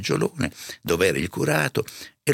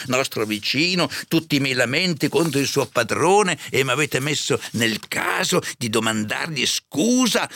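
high, exasperated curate; indignant reproach; 8 semitones higher, 3 dB louder than usual. A narrator's reading. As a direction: throw old offences back at her, high-pitched. Curato rinfaccia le chiacchiere passate. (rimprovero indignato, voce acuta)

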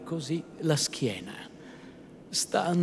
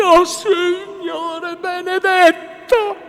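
very high, slow, quavering exclamation; high-pitched trembling astonishment; trembling woman's cry; 10 dB louder, much slower than usual. A narrator's reading. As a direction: cry out, even more overwhelmed. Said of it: "replicò ancor più tremante Lucia".

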